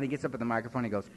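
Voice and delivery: normal talking voice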